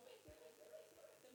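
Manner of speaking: whispered, frantic vete vete; the car thief, tormented, whispering at him to leave. Retold or imitated. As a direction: hiss it out like a haunted man. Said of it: "Pero así como atormentado".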